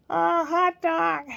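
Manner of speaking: put-on slow, high little-boy answer; child's answer. Grandson answering daddy about supper, slow child voice. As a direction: drag it out like a three-year-old.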